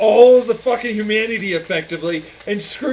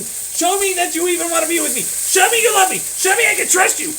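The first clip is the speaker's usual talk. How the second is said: building demands; 7 semitones higher than usual. Acted.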